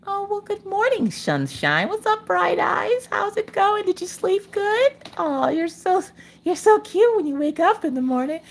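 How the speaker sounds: sing-song good-morning coo; sugary morning greeter. Pretend. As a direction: coo at him like a sweet morning host. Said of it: "you're just like".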